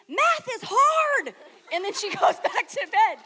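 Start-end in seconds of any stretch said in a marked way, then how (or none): 0.1-1.3 high, loud and very slow; sleepy declaration. Retold or imitated. Roommate wakes in the night and declares it, high and slow. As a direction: drag it out like a dazed sleeper. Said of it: "she wakes up again and she's like".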